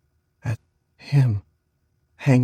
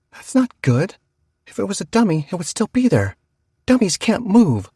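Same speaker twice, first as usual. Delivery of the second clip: put-on higher, louder alarmed child; 9 semitones higher, 5 dB louder than usual. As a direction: insist in rising alarm. Alarmed child arguing with sister.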